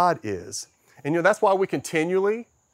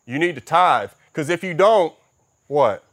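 mock guilt-trip pastor, slowing and loud on the threat; pushy pastor; 2 semitones higher, 6 dB louder than usual. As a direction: ham up a manipulative preacher. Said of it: "the pastor said".

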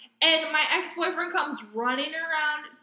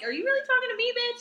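incredulous sass; 9 semitones higher, faster than usual. Retold or imitated.

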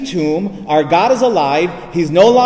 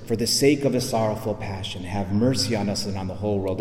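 lower, softer recited chaplet; quiet prayer; 6 semitones lower, 7 dB quieter than usual. Retold or imitated. Priest praying beside a dying man, quietly. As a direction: pray it quietly, just within earshot. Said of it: "he's saying it quietly".

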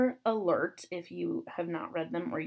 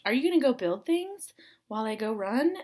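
higher put-on voice, imitating the roommate; mock roommate voice; 5 semitones higher than usual. Quoting the roommate's question in passing, mock higher voice. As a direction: do an inaccurate, higher-pitched take on a roommate. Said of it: "she doesn't sound like that".

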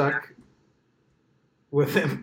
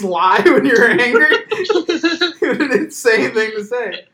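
laughing; 6 semitones higher, 6 dB louder, slower than usual. Real, not acted. Laughing at absurd excuse.

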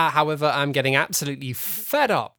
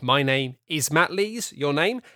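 bright, high show-host greeting; podcast host intro; 7 semitones higher, 4 dB louder than usual. Acted. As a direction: welcome everyone like a radio host.

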